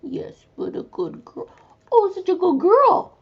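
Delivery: high, loud cooing praise. Real, not acted. Praising the dog.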